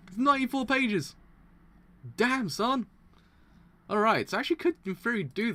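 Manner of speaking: high and loud disbelief; surprised. Real, not acted. Streamer shocked at how many pages to read, high loud surprise. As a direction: react like the number just floored you.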